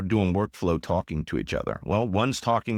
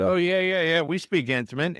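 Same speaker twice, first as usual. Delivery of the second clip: a teasing, overeager yes, like a naive tourist; mock eager yes; 9 semitones higher than usual. Joking answer to Saudi colleague.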